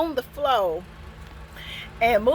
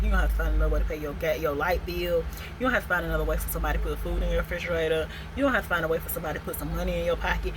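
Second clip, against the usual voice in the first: fast, lower, relentless listing; retold ex's cold fast ultimatum; cold rapid lecture; 6 semitones lower, 4 dB quieter, much faster than usual. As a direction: rattle off the threats fast. Angry ex lists cut-off bills.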